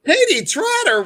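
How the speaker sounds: a high, loud squeal of recognition; excited shout. Real, not acted. Host spotting an old friend online, squealing her name. Host spots a surprise viewer.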